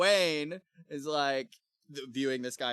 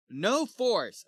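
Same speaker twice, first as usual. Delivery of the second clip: higher, louder bold declaration; Kay; 9 semitones higher, 5 dB louder than usual. Retold or imitated. Sir Kay brushes off his companions' doubts, bold declaration. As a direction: declare "No force" boldly, pitched up. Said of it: "Kay's like".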